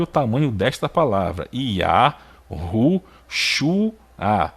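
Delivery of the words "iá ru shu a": each syllable separated by a pause; sílaba por sílaba. Shown as a demonstration. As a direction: break the name into syllables to count them. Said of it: "olha só quantas sílabas tem aqui". (sílaba por sílaba, com pausas)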